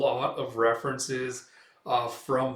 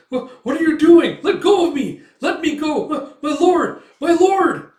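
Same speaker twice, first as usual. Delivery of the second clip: much higher, much louder terrified pleading; panicked screaming; 14 dB louder than usual. Acted. The mayor screams to his lord as the guards seize him. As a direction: scream for mercy as you're dragged away.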